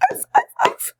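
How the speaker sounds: high yappy puppy barks from inside a box; playful high arf arf; dog barks. Acted. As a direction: bark like a small excited dog. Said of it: "Did that box just bark?".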